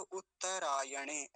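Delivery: उत्तरायणे slow, as "ut-ta-rayane"; careful, stretched उत्तरायणे; correct pronunciation. Shown as a demonstration. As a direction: sound every vowel in उत्तरायणे. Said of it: "ऐसा ही बोलेंगे".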